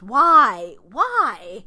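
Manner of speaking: loud, baffled why; exasperated shout. Real, not acted. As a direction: throw up your hands and shout it. Exasperated at made-up words.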